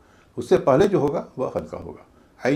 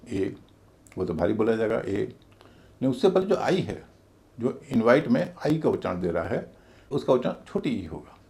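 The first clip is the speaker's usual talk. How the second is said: ordinary delivery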